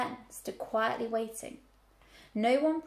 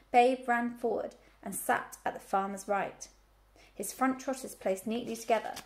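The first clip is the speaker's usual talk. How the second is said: usual speaking voice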